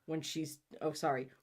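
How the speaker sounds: ordinary delivery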